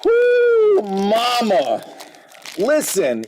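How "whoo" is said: high loud whoop; excited whoop. Real, not acted. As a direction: cheer at the big pull. Card reveal cheer.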